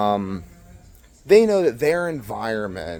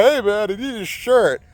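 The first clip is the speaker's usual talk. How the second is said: whiny cokehead; 6 semitones higher than usual. Retold or imitated. Mimicking a cokehead asking for shirt.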